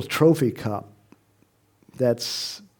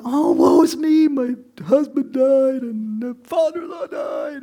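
much higher, louder wailing lament; mock woe-is-me wail, high and theatrical; 12 semitones higher, 3 dB louder than usual. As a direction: ham up a grieving widow's wail.

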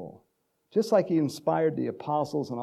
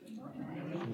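whisper; 17 dB quieter, slower than usual. Pretend. Pastor teasing the congregation with a whispered good morning. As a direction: drop to a breathy whisper, playful. Mock whispered second greeting.

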